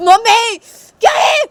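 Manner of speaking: shrieked high protest at a slap; acted childish shriek; outraged cry. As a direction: yell at your mother, stung. Slapped Sanju cries out.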